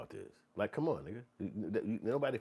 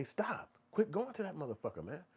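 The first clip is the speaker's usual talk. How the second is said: mock exasperated friend; 8 semitones higher than usual. Friends telling to quit.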